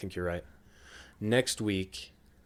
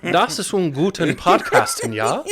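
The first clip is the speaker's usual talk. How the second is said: put-on loud, high movie villain German accent; hammed-up German accent while laughing; fake German accent; 9 semitones higher, 11 dB louder than usual. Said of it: "you do a pretty good fake German accent".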